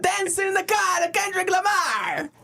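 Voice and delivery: hammed-up imitation of an excited fan's thick accent; Jamaican-sounding accent. Fan calling out to the dancer he spotted, excited Jamaican-sounding accent. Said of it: "making him sound Jamaican".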